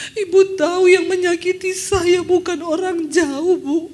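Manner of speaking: high, loud, slow crying voice; tearful confession. Retold or imitated. Weeping woman confessing her family hurt her, crying. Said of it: "Dia nangis Saudara".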